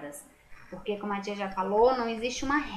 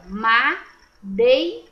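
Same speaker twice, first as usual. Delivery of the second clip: "Madeira" drawn out slowly, syllable by syllable, a bit louder; teacher sounding out "Madeira" slowly; split into syllables; 6 dB louder, much slower than usual. Shown as a demonstration. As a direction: stretch "Madeira" out for the class to hear each syllable. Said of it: "O E e o I fica juntinho na mesma sílaba".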